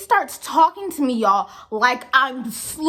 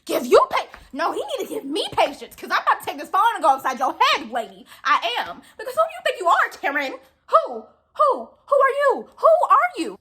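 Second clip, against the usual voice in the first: retelling a blow-up at a rude customer, pitched way up; mock outraged comeback; 6 semitones higher than usual.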